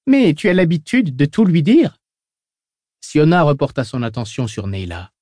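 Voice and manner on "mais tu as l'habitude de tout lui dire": higher squeaky little voice; a whiny squeaky man pipes up. A narrator's reading. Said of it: "Il avait une petite voix couinante".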